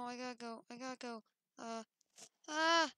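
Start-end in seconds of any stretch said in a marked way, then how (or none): 2.5-2.9 high loud startled scream; sharp frightened yelp; short scream. Real, not acted. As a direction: shriek at a jump scare. Jump scare.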